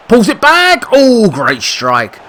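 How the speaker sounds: high, loud cry, then a slow drawn-out oh; big commentator call on the strike; loud soaring goal call; excited shout. Real, not acted.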